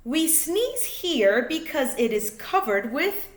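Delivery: lively, sing-song picture book reading; reading to children. A narrator's reading. Reading a rhyming space book to kids, sing-song. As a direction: bounce the rhymes for little listeners.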